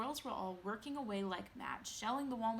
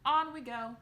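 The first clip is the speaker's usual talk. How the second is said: calling out; 5 semitones higher, 11 dB louder than usual. A narrator's reading. Wonka calling group.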